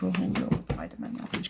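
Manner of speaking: hushed whisper to the cards; quiet whispered question over the cards. Real, not acted.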